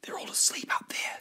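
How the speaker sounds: hushed conspiratorial whisper; voiceless, very soft. Acted. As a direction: whisper so the sleepers don't hear. Plotting beside a yacht of sleepers.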